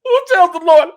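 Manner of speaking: Who tells the Lord, shouted high and loud; hammed-up outburst at Who. Shown as a demonstration. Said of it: "repeated louder".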